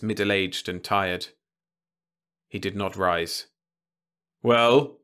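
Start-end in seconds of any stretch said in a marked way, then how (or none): 4.4-5.0 a sharp, loud, curt question; curt loud snap; snapped. A narrator's reading. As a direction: bite the word off, impatient. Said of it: "he snapped".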